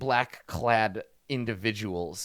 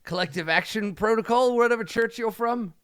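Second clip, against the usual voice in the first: thug pushing the union pitch, louder and higher; earnest union thug; 10 semitones higher, 6 dB louder than usual. Acted. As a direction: sell collective action with zeal. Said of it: "He's like".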